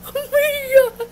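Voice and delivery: high squeal, nearly crying; squealing fangirl. Retold or imitated. To her idol, a breathless high squeal of disbelief. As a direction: shriek it out, overwhelmed with joy. Fan meets idol, freaking out.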